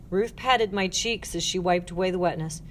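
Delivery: plain reading voice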